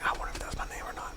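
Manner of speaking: hushed whisper to himself; anxious whisper while listening for his name; whispered wondering. Retold or imitated. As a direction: breathe it out, barely voiced. Airport, straining to hear intercom.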